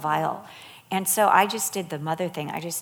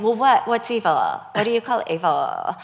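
mock challenge; 2 semitones higher, 7 dB louder than usual. Imitating people who say they don't know evil, mock challenge. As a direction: imitate someone defiantly quibbling, louder.

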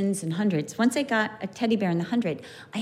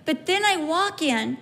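ordinary delivery

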